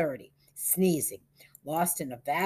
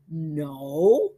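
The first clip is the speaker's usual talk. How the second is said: a drawn-out, rising no; thoughtful; 6 dB louder than usual. A narrator's reading. Peter considering the offer over the phone, thoughtful. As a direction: let the word climb as he weighs it.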